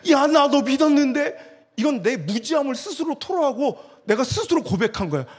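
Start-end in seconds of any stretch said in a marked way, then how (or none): 0.1-1.5 louder, slow self-pitying complaint; mock whine. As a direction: ham up the hurt believer. Mocking betrayed self complaint.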